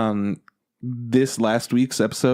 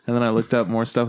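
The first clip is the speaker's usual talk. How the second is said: ordinary talk, nothing marked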